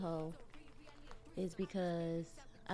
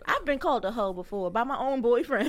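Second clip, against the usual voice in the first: laughing; 4 semitones higher, 8 dB louder than usual. Real, not acted. Laughing while telling story.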